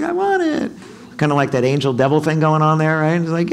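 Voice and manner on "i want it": high, pleading "I want it"; childish wanting voice. Pretend. Heart pleading to buy something, high childish whine. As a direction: whine it like a greedy inner child. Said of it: "Your heart's like".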